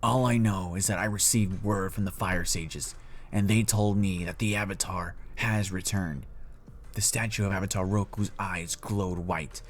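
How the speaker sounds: grave Fire Lord. Acted. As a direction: deliver grim news with weighty pauses. Storyteller voices the Fire Lord father.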